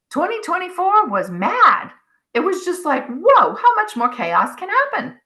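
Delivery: recalling a mad year, exasperated amazement; exasperated disbelief. Retold or imitated. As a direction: throw your hands up at the chaos. Retelling a chaotic year.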